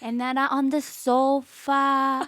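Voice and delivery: rambling mock Italian-accented English; slow, drawn-out Italian grandma impression; imitated Italian grandmother. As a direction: ramble like an Italian nonna, stretching phrase ends. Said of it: "every three words Nonna says".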